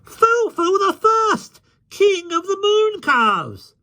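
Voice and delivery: very high, drawn-out mocking shriek; shrieked suggestion. A narrator's reading. Tinker shouts a mock title for the boy, high shriek. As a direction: shriek it out slowly at the top of the voice. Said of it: "A tinker shrieked out a suggestion".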